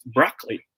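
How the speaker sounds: "broccoli" said as BRAH-klee; proper open O. Shown as a demonstration. Teacher reveals the proper way, BRAH-klee broccoli. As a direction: say "broccoli" in two beats, open first vowel. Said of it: "The proper way to say this word".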